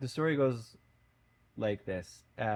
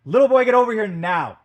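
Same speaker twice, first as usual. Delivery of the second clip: full yell, far higher and louder; furious yell; 13 semitones higher, 18 dB louder than usual. A narrator's reading. Old man finally yells at the boy, furious. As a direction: bellow it across the beach, fully angry. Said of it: "the old man yells".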